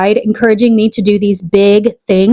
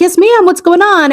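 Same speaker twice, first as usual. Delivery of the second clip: bright polite reply; 8 semitones higher than usual. Retold or imitated. Own polite reply retold.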